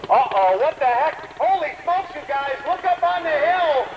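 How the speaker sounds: high, urgent announcer alarm; alarmed excitement. Acted. Announcer alerts the crowd to the bomb, high and alarmed.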